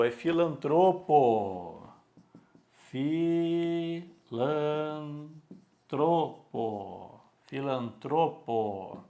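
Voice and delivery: filantropo said slowly, stress on tro. Shown as a demonstration. Teacher drilling filantropo, stress on tro, slowly. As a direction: stretch filantropo, leaning on tro. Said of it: "não é filântropo".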